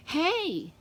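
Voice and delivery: yell. A narrator's reading. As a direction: call out to the boy. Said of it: "I yell".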